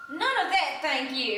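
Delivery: sharp, loud and high, cutting off the singing; snapped. Acted. Belinda silencing her party guests, a loud sharp snap. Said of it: "Belinda Kite snapped".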